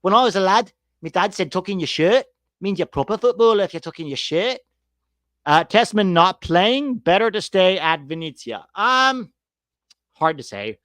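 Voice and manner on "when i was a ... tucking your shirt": high, louder Scouse bloke; lightly voiced Scouse lad; mock Scouse dad. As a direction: play the proud working-man Scouser. Mock Scouse working-man voice.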